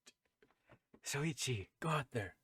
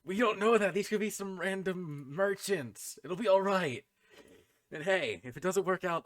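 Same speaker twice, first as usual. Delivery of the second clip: raised, higher voice, talking over objections; loud insistent reassurance; 7 semitones higher, 9 dB louder than usual. Acted. Character reassures companion before shoving him out, loud and insistent. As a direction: brush off the worry loudly.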